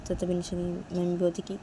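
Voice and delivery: ordinary delivery, nothing marked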